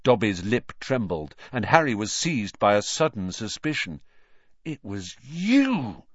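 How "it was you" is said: slow, drawn-out accusation; slow and a little higher; slow realisation. A narrator's reading. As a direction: say it slowly, leaning on each word. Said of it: "he said slowly".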